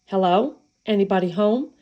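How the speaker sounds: plain reading voice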